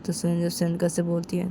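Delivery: ordinary delivery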